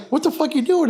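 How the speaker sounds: high-pitched mock bewilderment; startled protest. Bouncer imitating the big football player in photo, startled high protest. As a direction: go high, as if caught off guard.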